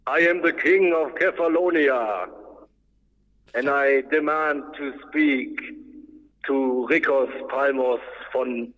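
echoing king's proclamation into a well; roaring tyrant king. Pretend. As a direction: speak down a well, booming echo. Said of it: "Du hast da voll den Hall drauf". (hallende Königsansage aus dem Brunnen)

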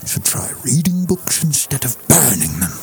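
put-on Scottish Connery accent, dry and quiet; Scottish-accented Connery line, quietly scornful; Sean Connery impression. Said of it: "How about my Sean Connery?".

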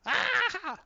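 high, loud mock cackle; Joker cackle. Host voicing the Joker laughing at his own riddle, high loud cackle. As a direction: burst into a shrill villain laugh.

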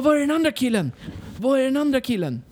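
high-pitched mimicry of urgent locals; frantic onlookers. Locals asking the seated rider about another victim, high frantic imitation.

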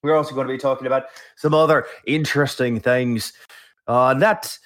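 mock-Scottish brogue that veers toward Irish; hammed-up Scottish accent. Host opens the show posing as co-host Chris, hammed-up Scottish accent. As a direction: play a Scot who sounds half leprechaun. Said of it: "That was a Scottish accent".

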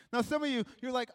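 ordinary talk

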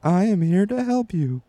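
a lightly voiced service robot, slow on the offer to help; asked for his best robot, a polite helper-bot greeting. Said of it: "Can you do your best robot?".

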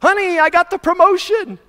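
high, loud burst of good news; excited. Acted. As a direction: cry it out to your wife. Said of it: "anytime we're excited".

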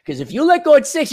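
usual speaking voice

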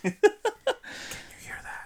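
airy whisper, drawn out slow. Acted. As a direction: breathe it into the mic. Testing new mics.

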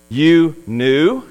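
master questions the servant with sarcasm; a doubting, mocking "You knew?" with the rise on "knew"; sarcastic. The master scoffs at the servant's excuse in a sarcastic tone. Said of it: "you can almost hear the sarcasm in his tone".

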